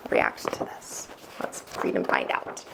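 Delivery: hushed, breathy-free whisper of suspense; whispered teaser. Acted. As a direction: lean in and whisper the question. Teaser whisper before story.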